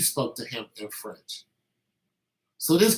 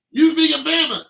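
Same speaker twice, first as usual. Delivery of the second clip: much higher and louder, gleeful mock; laughing punchline; 10 dB louder than usual. Repeating the joke about Alabama speech to listeners, in a gleeful loud voice. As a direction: crow it out like a punchline.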